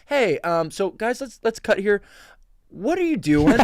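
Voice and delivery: high, loud quoted director; imitating a puzzled director. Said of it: "the director goes".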